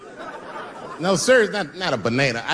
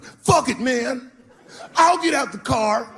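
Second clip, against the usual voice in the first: higher, slowed cocky dare; a hint of a cocky bro; 8 semitones higher, slower than usual. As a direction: call his bluff, smug. Cocky guy in back seat.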